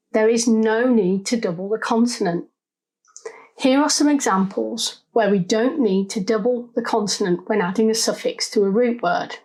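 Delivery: normal talking voice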